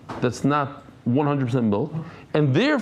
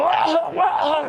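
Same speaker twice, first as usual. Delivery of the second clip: high mock gagging ah ah; retching sounds; slower than usual. Friend forcing himself to vomit by the road, high retching gags. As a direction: imitate someone retching to throw up.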